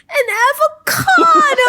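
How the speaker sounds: very high, loud, drawn-out squeal; excited little boy. Retold or imitated. Little boy opening his prank Christmas present, excited squeal. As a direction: squeal like a child thrilled by a gift.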